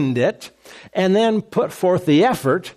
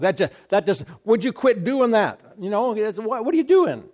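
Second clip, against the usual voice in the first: exasperated mock complaint; 6 semitones higher than usual. Big dog owner exasperated.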